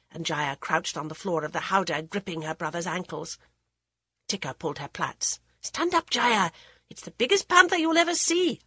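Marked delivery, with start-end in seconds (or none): 5.6-8.6 put-on excited older brother. Brother urges his sister.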